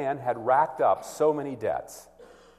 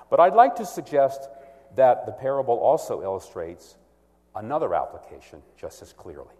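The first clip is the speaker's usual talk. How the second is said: ordinary talk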